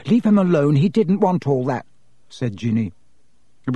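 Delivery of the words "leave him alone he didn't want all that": indignant defence. Acted. Ginny's first outburst to defend Harry, indignant. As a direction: jump in loudly to defend him.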